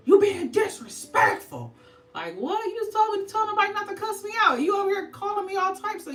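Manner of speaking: mock parent outrage. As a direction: ham up the outraged old-school parent. Mocking old-school parents.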